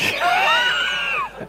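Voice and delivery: sudden high burst of real laughter. Real, not acted. Laughs at own joke.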